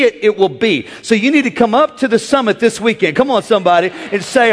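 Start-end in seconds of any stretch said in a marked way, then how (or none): none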